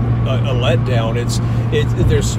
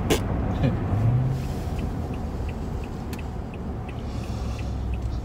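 breathy laugh; 9 dB quieter than usual. Real, not acted. Yawning laugh at partner.

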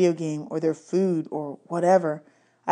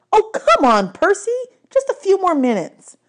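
high, whining plea; whiny girl voice; 13 semitones higher, 5 dB louder than usual. A narrator's reading. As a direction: whine for more time. Annabeth whining to stay.

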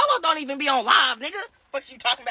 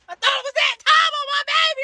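shouted; 14 semitones higher than usual. Real, not acted. Repeats line louder, higher.

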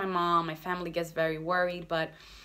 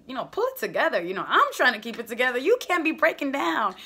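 retold plea, louder and rising; urgent pleading with dad; 6 semitones higher, 8 dB louder than usual. Daughter urging her weeping father in the doctor's office, loud and pressing. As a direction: press dad hard to pull it together, voice up.

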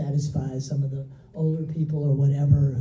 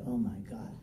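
muttered oh my God under the breath, softer; a hushed gulp of retold dread; half-voiced dismay before the door; quiet dread; 4 semitones lower, 14 dB quieter than usual. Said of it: "And I'm like".

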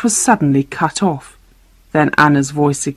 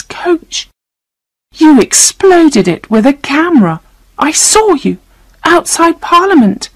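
put-on high, distressed girl's accusation; high-pitched, appalled outburst; horrified accusation; 9 semitones higher than usual. A narrator's reading. Terrified girl accuses the bombers.

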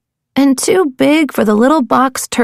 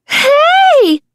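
startled yelp. Acted. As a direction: jump with surprise. Startled voice in bad shell.

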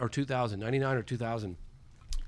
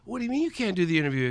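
higher, quick, mock-incredulous comeback; incredulous protest; 6 semitones higher, faster than usual. Interviewer told the interview is off, incredulous protest. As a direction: act out a fast, disbelieving objection.

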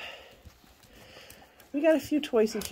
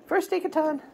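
normal talking voice

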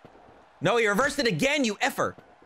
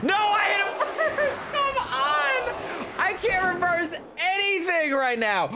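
high whiny yell; raging gamer; 9 semitones higher than usual. Real, not acted. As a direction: yell at the game in outrage. Furious at the game.

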